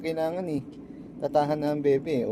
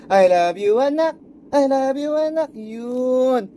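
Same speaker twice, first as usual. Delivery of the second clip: affectionate cooing; 6 semitones higher, 5 dB louder, slower than usual. Real, not acted. Dad telling his child I love you, high loving coo.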